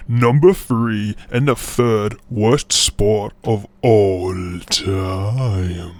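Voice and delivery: slow, stretched-out title announcement; drawn-out announcer ending; dramatic countdown. Pretend.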